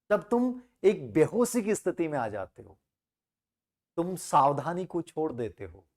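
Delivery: usual speaking voice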